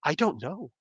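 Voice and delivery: soft, helpless imitation of himself; retold quiet bafflement. Teacher replaying his answer to colleagues, softly baffled. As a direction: drop the voice, as if at a loss.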